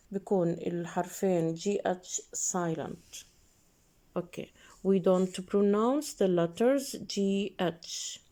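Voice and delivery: normal talking voice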